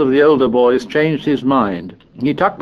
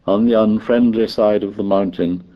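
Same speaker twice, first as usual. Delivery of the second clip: plain reading voice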